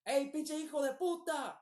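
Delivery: imitating a stranger calling out; retold insult yell. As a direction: holler the Spanish insult like the man in the truck. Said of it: "he's like".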